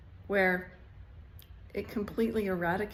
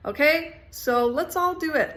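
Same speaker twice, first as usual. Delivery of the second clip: excited; 7 semitones higher, 6 dB louder than usual. Real, not acted. Rallying viewers to commit.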